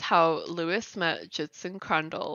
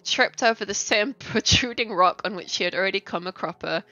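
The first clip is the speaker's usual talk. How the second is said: plain reading voice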